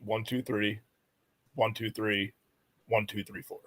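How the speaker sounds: soft "one, two, three" groups then "four", three three four; quiet three-three-four grouping; number cadence. Shown as a demonstration. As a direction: give the digits as three, three, four. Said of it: "if I had to do the area code".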